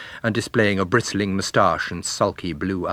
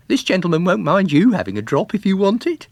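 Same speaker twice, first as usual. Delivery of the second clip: higher, louder cheeky lad; cocky young man; 10 semitones higher, 5 dB louder than usual. A narrator's reading. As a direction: coax her like a forward young fellow. Arthur pressing the girl.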